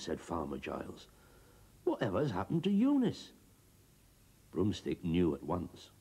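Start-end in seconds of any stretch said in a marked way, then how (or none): 1.8-3.3 astonished. Acted. Farmer shocked.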